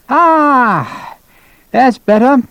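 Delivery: long high sigh of relief; relieved colonel. Acted. Colonel just bled by his doctor, relieved. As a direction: let out a high, satisfied "ah".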